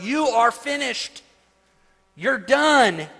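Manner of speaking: high, loud battle cry, drawn out; loud declaration of defeat, pitched high; soldier's yell. Acted. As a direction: yell it at charging enemies. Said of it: "he would yell this word".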